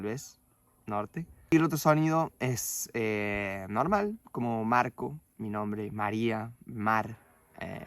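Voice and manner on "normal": ordinary delivery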